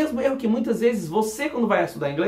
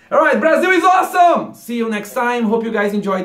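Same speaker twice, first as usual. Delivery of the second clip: loud, high enthusiastic shout; mock tourist cheer; 4 semitones higher, 6 dB louder than usual. Teacher ending the story with a mock tourist shout. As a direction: cheer like a happy American tourist.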